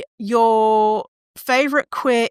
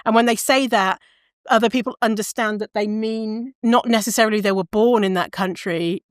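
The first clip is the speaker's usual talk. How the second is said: normal talking voice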